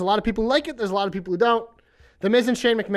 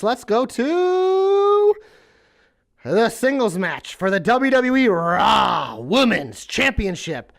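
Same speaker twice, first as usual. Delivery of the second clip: slow, drawn-out ring announcer; much slower than usual. Pretend. Ring announcer introducing the match.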